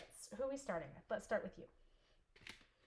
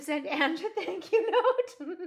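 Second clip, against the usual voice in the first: high, loud, laughing build; giggling; 10 semitones higher, 14 dB louder, slower than usual. Real, not acted. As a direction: break up into high giggles as you finish. Giggling at her own story.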